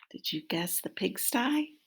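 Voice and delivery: usual speaking voice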